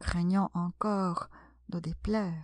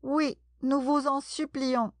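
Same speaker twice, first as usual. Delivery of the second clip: higher, eager plea; supplication en chœur; 5 semitones higher, 3 dB louder than usual. A narrator's reading. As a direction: beg as one of a crowd, voice lifted. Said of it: "tous les autres dirent en chœur". (supplication, d'une voix plus haute)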